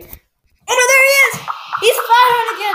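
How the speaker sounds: high, loud mock-terrified yell; play panic shouting. Podcaster sees gunman return, high panicked shout. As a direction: shriek a pretend alarm.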